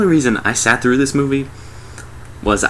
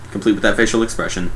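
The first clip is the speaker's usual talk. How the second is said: normal talking voice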